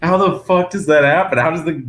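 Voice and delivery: high, incredulous mock scolding; imitated exasperated father; mildly angry dad. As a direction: rant in disbelief, easing down at the end. Dad's baffled tirade continues.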